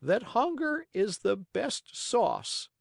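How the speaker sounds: high, slow bird voice; high-pitched wise pigeon; advising pigeon. Acted. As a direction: deliver a saying slowly in a high voice. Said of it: "answered the pigeon".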